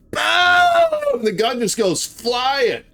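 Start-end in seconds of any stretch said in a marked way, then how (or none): 0.1-1.2 very high loud shouted boom; imitated gunshot boom. Teller imitating the shotgun blast, very high loud boom. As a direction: blast out the shot. Gun going off.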